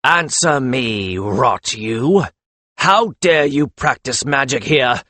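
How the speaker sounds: slow, forceful outrage; enraged Magus confronting the healer; angry demand. A narrator's reading. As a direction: demand an answer furiously. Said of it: "transferred the glare to the healer".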